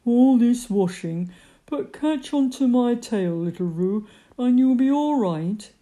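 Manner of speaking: understated grumble; grumbling to himself. A narrator's reading. Eeyore offers his tail to Roo, mildly grumbling. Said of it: "grumbling quietly to himself".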